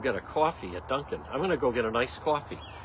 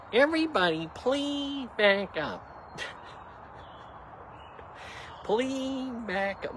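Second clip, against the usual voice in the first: slow plea; 8 semitones higher, 4 dB quieter, slower than usual. Real, not acted. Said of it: "pleading to crowd".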